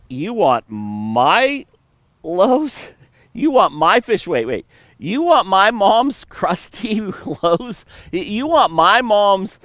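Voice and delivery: put-on high, disbelieving boy pleading over his lunch; incredulous kid. Boy asked to hand over his mom's stale bread, incredulous and rising. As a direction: stretch each question up in disbelief. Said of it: "the kid's like".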